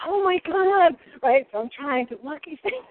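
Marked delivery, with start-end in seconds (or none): 0.1-1.0 high, loud "oh my God" in mock horror; shocked gasp. Retelling kitchen mishap to host, shocked outcry. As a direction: blurt it out like you just saw disaster. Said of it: "And I'm like".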